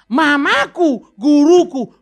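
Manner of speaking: "Mamaku" drawn out very slowly and loud; slow loud proclamation; proclaimed, loud. Acted. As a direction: declaim it like a banner. Proclaiming mother as teacher.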